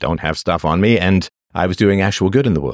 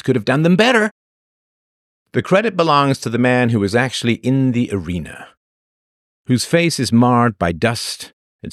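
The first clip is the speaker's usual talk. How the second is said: quote recitation; 3 semitones higher than usual. Acted. Reading Roosevelt quote.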